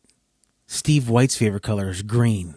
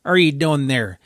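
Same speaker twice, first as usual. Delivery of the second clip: loud, higher-pitched hammed-up Canadian accent; mock Canadian accent, called out loudly; Edmonton Canadian accent; 6 semitones higher, 7 dB louder than usual. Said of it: "They both say".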